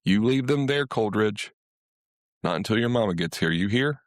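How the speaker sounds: a hint of a stern grandmother scolding; lightly voiced grandma warning the boy off. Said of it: "grandmother's voice cracked over him like a lightning bolt".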